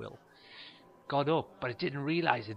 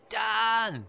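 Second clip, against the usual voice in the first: high, loud call of a name; shout from a window; 9 semitones higher, 8 dB louder than usual. Retold or imitated. Man leaning out a window, shouting a name. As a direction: bellow up at the street.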